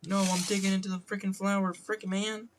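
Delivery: high, put-on voice of mock frustration; fake-swear in a high squeal; fake cursing. Streamer avoiding real curses, high mock-frustrated voice. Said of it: "like, the fake curses".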